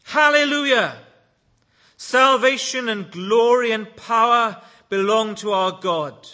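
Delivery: loud, high hallelujah shout; shouting crowd. A narrator's reading. Heavenly crowd praising God, shouted out loud. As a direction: cry it out like a roaring multitude.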